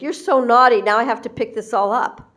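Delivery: loud exasperated scold; a mother scolding her child over the mess. Retold or imitated. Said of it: "the mother's like".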